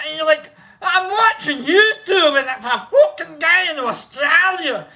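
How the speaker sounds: animated, hammed-up Scottish ramble; bad Scottish accent. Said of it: "That's a shitty Scottish accent".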